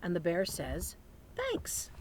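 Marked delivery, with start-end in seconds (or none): 1.3-1.9 high squeaky thanks. Acted. Bear voice.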